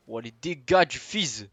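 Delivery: slow, stretched furious yell; raging gamer. Real, not acted. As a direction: bellow it in outrage. Shouting, stretched out.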